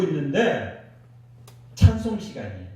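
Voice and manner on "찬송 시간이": ordinary delivery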